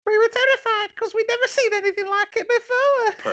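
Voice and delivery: very high, loud, eerie quoting voice; spooky high voice. Shown as a demonstration. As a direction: pitch it way up, ghostly and loud. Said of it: "Do the voice".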